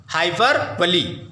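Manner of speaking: "हाइपरबोली" slowly, in parts, ending in ee; slow teacher's model of "हाइपरबोली" with final "lee"; pronunciation model. Shown as a demonstration. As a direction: spell out the sound of "हाइपरबोली": hai-per, ba-lee. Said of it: "क्या पढ़ते हैं?".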